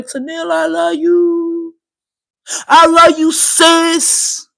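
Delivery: high, stretched-out "I love you" in imitation; sing-song mock adoration; mock screamed affection. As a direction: mimic gushing fans, drawing out the words. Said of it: "these are the same motherfuckers that be screaming".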